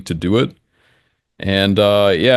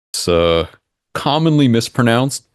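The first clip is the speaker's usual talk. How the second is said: ordinary talk, nothing marked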